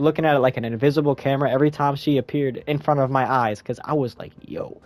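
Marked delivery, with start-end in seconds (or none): 4.4-4.8 smitten low drawl. Retold or imitated. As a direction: savor every word like you're dazzled. Admiring Cat Grant.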